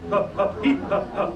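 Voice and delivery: sharp breathy ha ha pulses; diaphragm breath pulses. Shown as a demonstration. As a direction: punch each ha from the belly. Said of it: "they should be coming from right here".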